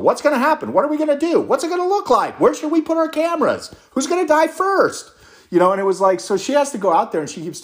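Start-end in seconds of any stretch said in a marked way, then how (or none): 0.0-5.1 high, rapid-fire mocking reporter questions; frantic reporters. Press pack hammering the official, frantic and high-pitched. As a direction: pepper her with questions like a frenzied press pack. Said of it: "constantly peppering them with questions".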